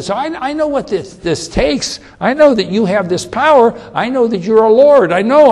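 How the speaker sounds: high-pitched, rising insistence; fervent believer. Acted. Centurion declaring faith in Jesus's power, high fervent insistence. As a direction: pour out conviction.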